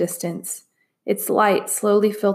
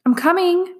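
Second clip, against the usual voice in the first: higher, calling out; called out; 11 semitones higher than usual. A narrator's reading. Min Lee answers the trapped voice, calling out. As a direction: reassure her from afar. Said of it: "Min Lee called again".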